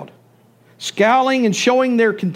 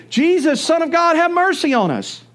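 raised, pleading call for mercy, pitched higher; 6 semitones higher than usual. Acted. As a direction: call out to Jesus as he passes, a bit higher. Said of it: "they began to say".

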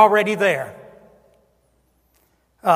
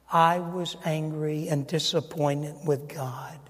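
subdued confession; 3 semitones lower, 7 dB quieter than usual. Retold or imitated. Retold confession of hurt.

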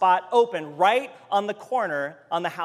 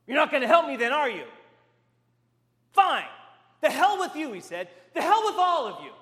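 bitter angry; 7 semitones higher, 4 dB louder, faster than usual. Retold or imitated. Angry man storming off.

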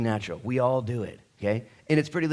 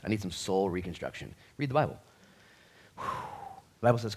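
usual speaking voice

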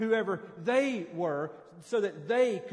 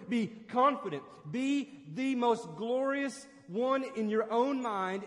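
rising preacher fervor, pushing higher; preaching at full force; 6 semitones higher, 4 dB louder than usual. Acted. Preacher rallying the congregation against worldly greatness, building fervor. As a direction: build the sermon to a crescendo.

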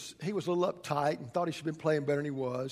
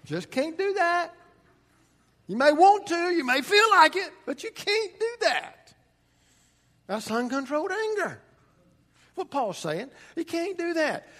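preaching at full force; 11 semitones higher, 8 dB louder than usual. Real, not acted. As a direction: drive it home from the pulpit. Pastor preaching on anger.

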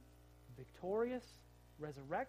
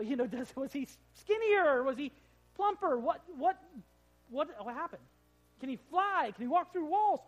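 higher and louder, tumbling eager questions; excited curiosity; 5 semitones higher, 10 dB louder than usual. Real, not acted. Preacher wondering aloud about the risen body, excited.

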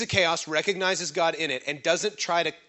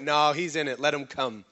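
dropped, lower, settled voice; calm assurance; 4 semitones lower than usual. Acted. Preacher voicing Hezekiah's response to the chaos, calm assurance. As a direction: wave the worry away calmly. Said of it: "he saw chaos and went".

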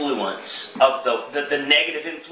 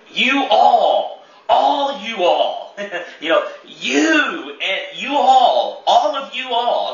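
"you all" punched higher and louder through laughter, slowed; emphatic you all; 6 semitones higher, 4 dB louder, slower than usual. Shown as a demonstration. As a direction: pastor laughs while hammering "you all" to the church. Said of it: "it's very emphatic".

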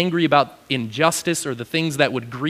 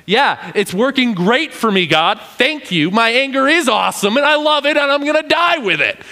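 much higher, mock-cheerful sarcasm; sarcastic Jonah; 8 semitones higher than usual. Jonah sarcastically answering God, high and mock-cheerful. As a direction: gush with bitter sarcasm. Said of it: "has the guts to".

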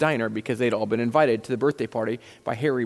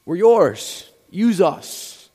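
louder, higher, emphatic appeal to God; prayerful plea; 5 semitones higher, 7 dB louder, much slower than usual. Real, not acted. Pastor offers the congregation to God, prayerful plea. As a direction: pray it out boldly in short bursts. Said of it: "as a way to say, God".

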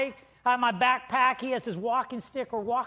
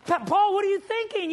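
alarmed Dwayne; 8 semitones higher, 5 dB louder than usual. Retold or imitated. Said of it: "He's like".

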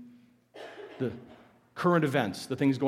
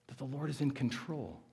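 soft repetition; 11 dB quieter than usual. Real, not acted. Repeated softly for emphasis.

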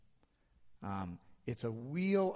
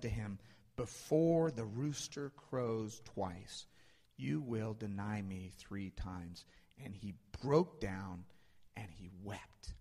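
solemn scripture reading; 2 semitones lower, slower than usual. A narrator's reading. Preacher reads Peter remembering Jesus' words, solemn and slow. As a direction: let it sink slowly to a hush.

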